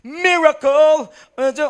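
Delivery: showy, drawn-out loud high belt; sadyang nilakasan. Acted. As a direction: belt it out on purpose to look devout. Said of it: "lalaksan ko po talaga yung boses ko on purpose". (sadyang malakas na pag-awit)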